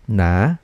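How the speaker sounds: "na" starting low and rising; second tone. Shown as a demonstration. Teacher drilling learners in second tone, "na" rising. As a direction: glide "na" upward from low. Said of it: "One more time".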